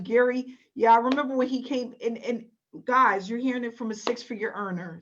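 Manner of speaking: ordinary talk, nothing marked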